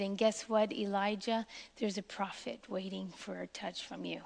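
hushed, near-whispered voice of God; still small voice. Acted. God quietly recommissioning the weary prophet, whispered. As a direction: breathe it out softly, like a secret. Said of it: "but God said".

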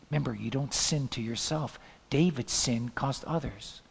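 normal talking voice

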